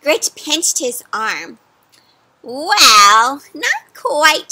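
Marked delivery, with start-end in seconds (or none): 2.4-4.5 grinning witch. Acted. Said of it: "she grinned".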